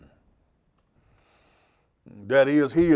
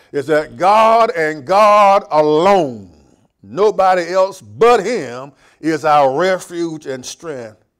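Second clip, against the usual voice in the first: slow, louder, stressed "God"; emphatic preaching; 3 semitones higher, 9 dB louder, slower than usual. Acted. Pastor drives home God as refuge, slow and emphatic. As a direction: lean hard on "God alone".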